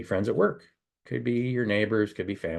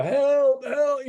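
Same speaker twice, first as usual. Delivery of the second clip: high, loud yelled help, replaying the panic; retold screaming for help; 5 dB louder than usual. Towed at seventy, yelling to brother ahead, high loud yell. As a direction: shout it out a car window at your brother. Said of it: "arms screaming out the window".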